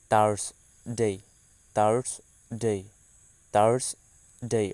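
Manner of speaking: থার্সডে drawn out very slowly, repeated; careful model pronunciation. Shown as a demonstration. Teacher repeating থার্সডে slowly for students. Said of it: "সেটার ইংরেজি উচ্চারণ হবে এমন".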